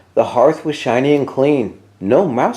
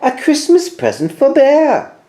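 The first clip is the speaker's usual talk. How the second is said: tiny Santa; 13 semitones higher, 4 dB louder than usual. A narrator's reading. Tiny Santa delivering present.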